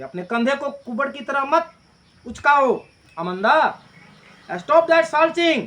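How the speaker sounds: loud, rising scold from a mother; a mother raising her voice at a slouching girl. Acted. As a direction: snap at the daughter to straighten up.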